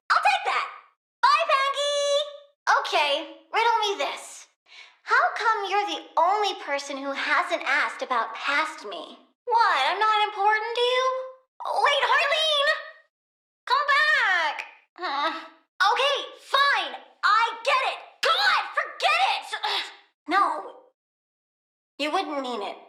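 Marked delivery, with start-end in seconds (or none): 0.1-2.8 high, drawn-out mock goodbye; mock a dismissive farewell, slowly; imitating Deadshot. Imitating Deadshot brushing off Pangy, slow mock goodbye. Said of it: "Deadshot said you were like". 11.6-14.6 high, slow pleading call. Acted. Plant girl calls after departing Harleen, high pleading. 15.9-19.5 high, offended huff; hurt snap. Acted. Plant girl stung by rejection, high offended huff.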